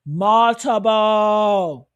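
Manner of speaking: Multiple stretched very slow and loud; drawn-out emphasis. Real, not acted. Stressing to viewers how many people fought, slow and drawn out. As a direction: let the word Multiple hang, heavy and slow.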